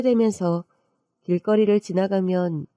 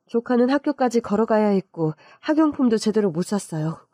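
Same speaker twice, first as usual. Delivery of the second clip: plain reading voice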